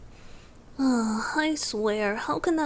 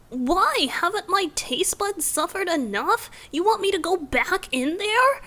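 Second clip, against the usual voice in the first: mock outrage; 8 semitones higher, 4 dB louder than usual. Playful protest at being sent away.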